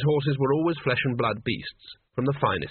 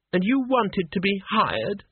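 high, scornful question; incredulous girl, pitched up and drawn out; snappish; 7 semitones higher, slower than usual. A narrator's reading. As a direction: snap at him in a higher voice. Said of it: "she snapped".

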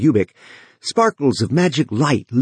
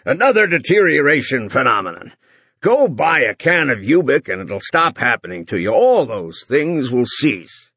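booming voice; 4 semitones higher than usual. Acted.

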